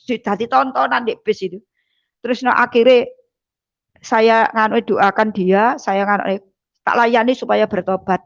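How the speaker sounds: ordinary delivery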